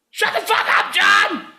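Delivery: high, loud demonstration yell. Shown as a demonstration. Sample lesson in yelling at John, high shout. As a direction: explode at him in a hammed-up yell. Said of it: "Such lessons as yelling at John".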